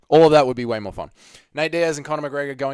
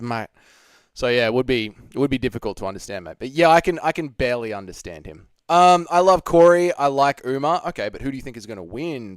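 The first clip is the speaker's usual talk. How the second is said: ordinary delivery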